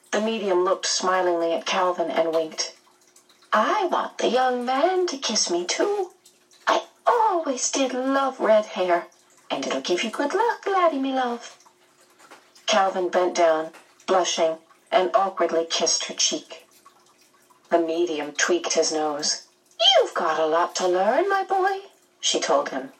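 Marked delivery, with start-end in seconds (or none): 3.5-11.6 high, playful medium voice; high fluting, coquettish medium; flirtatious medium. A narrator's reading. 19.8-21.8 teasing voice swooping up high; playful, high-swooping medium. A narrator's reading.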